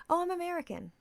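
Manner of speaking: high, mock-innocent reply; feigned innocence. Host playing dumb to a nosy stranger, mock-innocent. Plays herself answering coyly.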